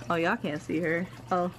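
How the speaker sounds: ordinary delivery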